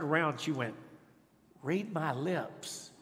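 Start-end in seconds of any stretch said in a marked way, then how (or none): 1.6-2.9 very slow, soft, drawn out; softly retold, savoring each word; tender child's quote. Daughter to her dad leaving the classroom, tenderly. Daughter mouths their loving ritual back.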